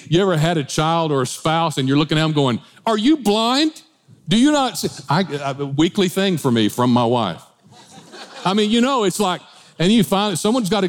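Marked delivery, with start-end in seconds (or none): 2.8-4.9 exasperated disbelief. Retold or imitated. Exasperated at spouse or child.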